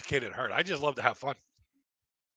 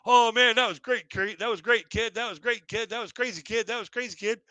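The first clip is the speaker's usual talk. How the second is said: higher, delighted praise; cheering crowd; 7 semitones higher than usual. Retold or imitated. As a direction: hype up the kid like an amazed chat. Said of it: "They're like".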